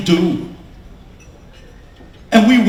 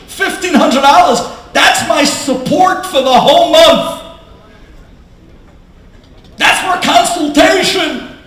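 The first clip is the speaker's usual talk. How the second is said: loud, high-pitched indignant outburst; outraged disbelief; 10 semitones higher, 8 dB louder than usual. Retold or imitated. Young kollel husband reacting to the doctor's fee, outraged. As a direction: protest the price at full volume.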